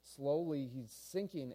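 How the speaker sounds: ordinary delivery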